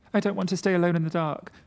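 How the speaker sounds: put-on high, fast, loud childlike fear; like a frightened child. Frightened friend begging not to be left alone, childlike. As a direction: blurt it out like a scared child. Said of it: "just as a frightened child might have done".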